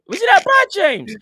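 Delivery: high, loud mimicked protest; mock-outraged quote. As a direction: throw it back as a high, loud cry. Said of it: "Matt just said".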